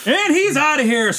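high, slow and excited. Retold or imitated. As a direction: announce his exit like a cheer. Acting out the scene.

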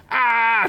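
high, short cry of alarm; startled shout. A narrator's reading. Duncan flinches toward the pillar, startled shout. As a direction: flinch and yell out. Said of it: "Duncan shouted as he flinched".

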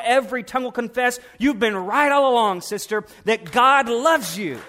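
loud, rising preaching climax; preaching at full force. Real, not acted. The preacher tells the sisters the world will bow: loud, rising preaching. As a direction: build it up and proclaim to the congregation.

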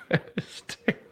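words breaking into silent laughter; wheezing with laughter; laughing helplessly. Real, not acted. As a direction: barely get it out through the laugh.

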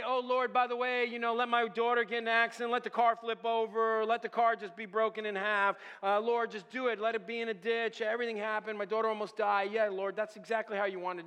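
loud, high, sarcastic pleading; hammed-up preacher's petition; mock prayer. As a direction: pray it as an absurd request.

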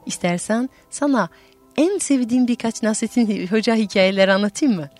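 ordinary delivery, nothing marked

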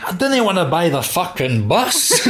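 high-pitched Glaswegian comedian impression, breaking into laughter; Glaswegian accent. Imitating comedian's bus passenger objecting to the fare, in a Glaswegian accent. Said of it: "he does this in a Glaswegian accent".

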